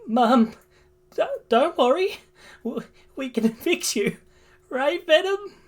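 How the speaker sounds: high, tearful stammer; distraught boy comforting his mother; crying. Acted. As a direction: plead through acted sobs. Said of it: "starts to cry".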